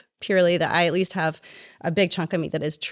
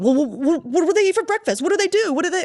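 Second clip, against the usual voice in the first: high, flustered mock husband; panicked husband; 10 semitones higher, 3 dB louder than usual. Woman mocking a panicked husband left alone with kids, high and flustered. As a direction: sputter like a lost dad. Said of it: "men in this country would be in panic mode".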